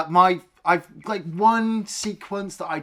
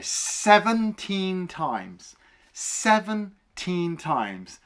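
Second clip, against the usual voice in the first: each word dragged out slowly; slow disbelief; 3 semitones higher, much slower than usual. Real, not acted. Stressing how often one shot was reused, slow disbelief. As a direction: stretch SEVENTEEN TIMES out, very slow.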